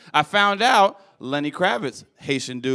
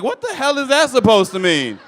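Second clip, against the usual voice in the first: indignant, louder and higher; mock outrage; 8 semitones higher, 7 dB louder than usual. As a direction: act offended by the question. Indignant retort to friend.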